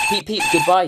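high, loud cheery whistle-call. Acted. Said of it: "he called".

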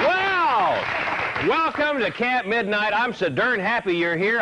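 high, gushing talk show welcome; excited showman. Acted. Host Dick welcoming the audience in high, gushing excitement. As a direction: greet the crowd like an over-eager host.

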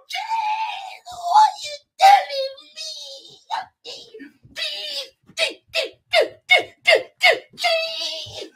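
very high, stretched-out celebration squeals; excited squealing. Real, not acted. Fan celebrating the reply, high excited squeals. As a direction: squeal it in falsetto, then stutter it out.

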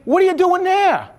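high, loud startled question; worried dad asking his son why he is there; alarmed father. Retold or imitated. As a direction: sound shocked at the news.

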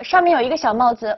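normal talking voice

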